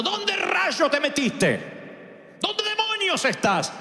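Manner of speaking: hammed-up anger, stretching out the words; imagined God calling out crossly for Adam; exasperated call. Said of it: "uno puede interpretar que Dios está diciendo".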